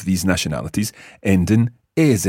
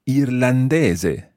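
irlandese drawn out slowly, eer-lan-DEH-zeh; slow model word; 6 semitones higher, much slower than usual. Shown as a demonstration. Teacher slowly models irlandese for listeners. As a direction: stretch out irlandese syllable by syllable. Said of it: "they would want to say".

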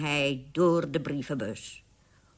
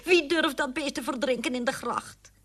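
disgusted mother; 9 semitones higher than usual. A narrator's reading. Mother seeks someone to drown it.

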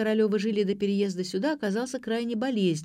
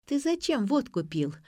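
restrained reproach, higher voice; seething; 7 semitones higher than usual. A narrator's reading. Daughter confronting her drinking father, seething mildly. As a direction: demand it with held-back anger. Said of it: "процедила дочь".

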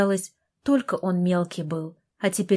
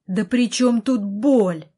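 louder, angry outburst; indignant yell; 3 semitones higher, 5 dB louder, slower than usual. A narrator's reading. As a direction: yell it back in exasperation. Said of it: "заорал я".

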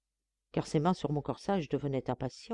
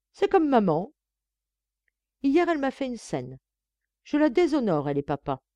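raised, animated comic patter; 5 semitones higher, 4 dB louder than usual. A narrator's reading. Son telling of his mother's scene, comic tone. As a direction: reel it off for laughs. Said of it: "il débita sur un ton comique".

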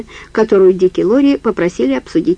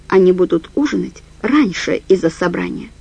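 plain reading voice

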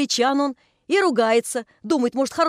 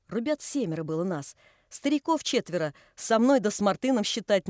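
ordinary delivery

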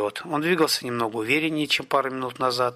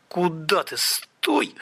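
urgent hissed cry, jumping high; sharp hiss; 6 semitones higher, much slower than usual. A narrator's reading. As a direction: hiss a frantic warning to stop. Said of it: "зашипел Камень".

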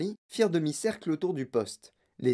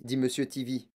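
ordinary delivery

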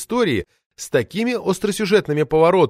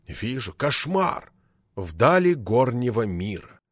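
stern boss; 2 semitones lower, slower than usual. Acted. Boss agrees reading newspaper.

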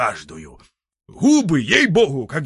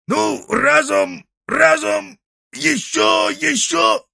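high, loud straining grunts; straining effort; 6 dB louder, slower than usual. Acted. Grandfather strains to haul the heavy cauldron out. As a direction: heave with each word.

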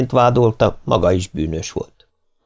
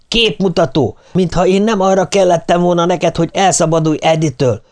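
high-pitched, heated tirade; angry yelling; 8 semitones higher than usual. A narrator's reading. Martin hurls accusations back at Nancy, high furious yelling. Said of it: "ordította Martin".

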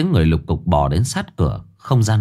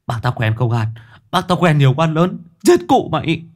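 hysterical threats; 8 semitones higher than usual. A narrator's reading. Captive screaming threats at the kidnapper, hysterical. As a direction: rage against the truth.

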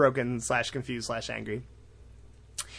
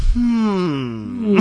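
long, high, loud pondering hum; hammed-up sleuth's hmm; suspicious hmm; 8 semitones higher, 8 dB louder than usual. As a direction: draw out a mock-suspicious hum. Katara ponders, eyes squint, suspicious.

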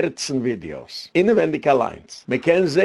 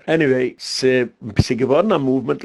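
normal talking voice

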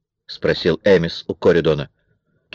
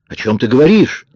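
higher, louder baffled demand; exclaimed question; 6 semitones higher, 5 dB louder than usual. A narrator's reading. Amis questions Kara's accusation, exclaiming. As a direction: demand an explanation, pitch jumping up. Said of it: "воскликнул Эмис".

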